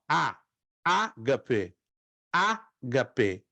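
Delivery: "ágape" low, its first A set apart; stress on first syllable. Shown as a demonstration. Teacher asking students to agree, first-syllable stress on "ágape". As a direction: separate and stress the opening A in "ágape". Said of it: "na primeira sílaba".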